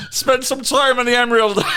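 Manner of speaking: high Irish brogue breaking into laughter; Irish impression. Guru recommending Ireland, hammed-up Irish. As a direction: ham up the Irish, near cracking up. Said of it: "Conor McGregor Peterson".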